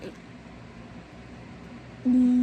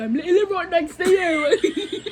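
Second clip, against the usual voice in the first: loud, slow laughing retort; 7 semitones higher, 5 dB louder, much slower than usual. Real, not acted. Sister laughing back at teasing in the next seat. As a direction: laugh it out at her.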